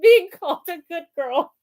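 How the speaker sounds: high giggling words; laughing out the phrase. Real, not acted. Co-host laughing about good girl praise. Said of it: "I can't stop laughing".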